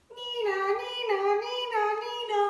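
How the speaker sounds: high sung nee-naw siren; playful ambulance siren; siren imitation. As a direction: sing it like an ambulance racing past.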